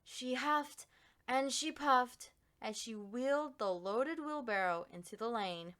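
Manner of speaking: ordinary delivery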